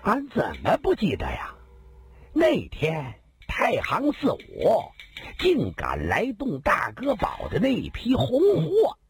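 high, boastful storyteller; swaggering bandit voice. Acted. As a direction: brag it with a grin. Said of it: "另一个人笑道".

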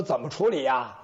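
low, amused chuckle through the question; mocking laugh. Magistrate chuckling at the jailer's question, low mocking laugh.

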